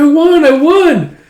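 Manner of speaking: high, loud victory cry; triumphant shout. Acted. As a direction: crow loudly over winning a race. Shouting after winning the race.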